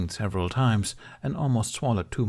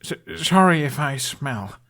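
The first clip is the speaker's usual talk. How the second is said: high, louder, slow stammering blurt; blurted apology; 6 semitones higher, 6 dB louder than usual. A narrator's reading. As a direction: blurt it out, stuttering in embarrassment. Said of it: "Hiccup immediately blurted".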